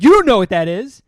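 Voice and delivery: loud, high, incredulous jeer; mocking kid. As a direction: yell it to humiliate him publicly.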